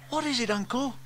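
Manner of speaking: put-on high little-child voice; high-pitched young nephew's question; James, curious. A narrator's reading.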